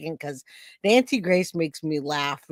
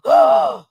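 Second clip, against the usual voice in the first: loud, very high theatrical gasp; dramatic gasp; 9 dB louder than usual. Pretend. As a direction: gasp on cue for Marilyn. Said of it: "Marilyn likes when I go".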